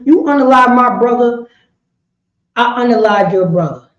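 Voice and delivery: ordinary delivery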